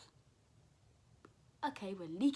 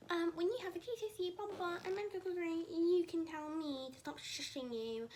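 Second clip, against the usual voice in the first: high, loud imitation of a lecturing tutor; exaggerated high-pitched lecture, rushed then slowing; mocking tutor; 9 semitones higher, 5 dB louder than usual. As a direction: mimic his comeback in a squeaky-high voice. Mimicking the tutor's reply.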